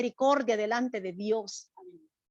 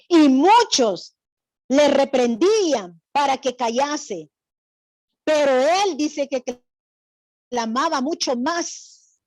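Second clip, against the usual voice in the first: much louder, slow proclamation; forceful scripture reading; 6 dB louder, slower than usual. Acted. Teacher proclaiming the verse to the class, loud and slow. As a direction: declaim the verse with full force.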